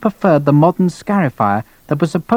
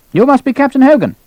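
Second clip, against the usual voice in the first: high-pitched, commanding query; brusque colonel; 11 semitones higher, 4 dB louder than usual. Acted. Colonel meeting a new captain, brusque.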